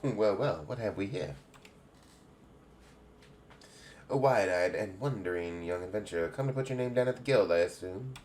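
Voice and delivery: put-on lightly English-accented guildmistress greeting; a hint of a welcoming English matron. Guild head welcomes a young adventurer, light English accent. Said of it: "I'm making everybody have an English accent".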